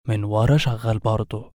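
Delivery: ordinary delivery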